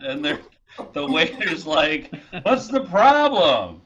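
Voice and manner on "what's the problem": loud waiter challenge. Acted. Waiter confronting bread customers.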